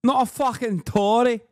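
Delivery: mock Scouser shout; Scouse accent. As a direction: yell it like a proud Scouser lad. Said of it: "struggles to understand the accent".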